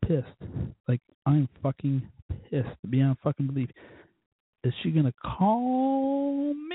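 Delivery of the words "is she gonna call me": high, long drawn-out call me; exasperated. Real, not acted. Venting about her calling, exasperated drawn-out rise. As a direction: stretch the last words upward in disbelief.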